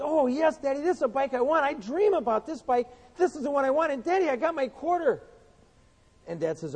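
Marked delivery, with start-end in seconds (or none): 0.0-5.2 put-on little boy voice. Preacher voicing Johnny begging his daddy, higher child voice. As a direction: beg like a six-year-old wanting a bike.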